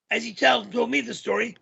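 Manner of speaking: ordinary delivery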